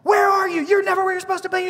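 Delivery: high, fast mock scolding; imitated angry yelling. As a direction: act out a coach yelling at a player. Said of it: "he's just yelling at Martellus".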